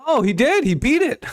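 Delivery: high, thrilled cry as the runner beats it; excited. Real, not acted. Streamer watching the runner slide in safe, excited high cry. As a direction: pitch jumps way up, genuinely stoked.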